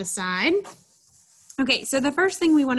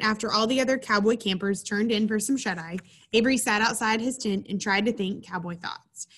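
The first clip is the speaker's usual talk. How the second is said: usual speaking voice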